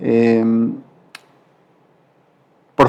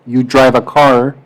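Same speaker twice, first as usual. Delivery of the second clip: English example sentence; slower than usual. Shown as a demonstration. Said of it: "Tú manejas un carro".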